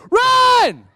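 very loud, high shouted cue; huge shout. Acted. Pastor shouting one word for students to echo, loud. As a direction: yell it for the whole room. Leader calls congregation response.

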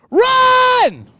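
very loud, high shouted cue; huge shout. Acted. Pastor shouting one word for students to echo, loud. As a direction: yell it for the whole room. Leader calls congregation response.

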